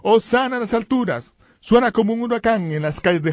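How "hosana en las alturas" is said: high-pitched mock cry of praise; grito imitado. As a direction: call it out like cheering priests, not at full volume. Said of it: "empiezan a gritar". (aclamación en tono alto)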